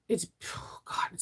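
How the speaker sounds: breathless whisper of delight. Real, not acted. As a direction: sigh it out under your breath. Swooning over a scent.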